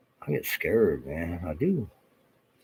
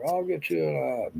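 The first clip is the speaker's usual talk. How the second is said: mock threat; 9 semitones higher than usual.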